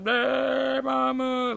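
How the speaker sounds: very high, drawn-out mock wail; crying little girl. Little girl screaming for her mom, hammed-up crying. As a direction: imitate a small girl bawling. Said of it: "screaming for her fucking mom".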